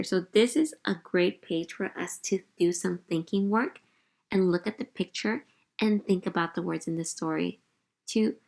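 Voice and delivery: ordinary delivery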